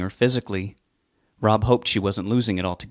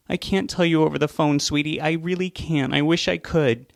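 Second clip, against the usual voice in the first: tearful; 6 semitones higher than usual. Acted. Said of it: "Why are you crying?".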